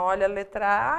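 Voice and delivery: ordinary talk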